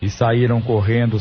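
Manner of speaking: ordinary talk, nothing marked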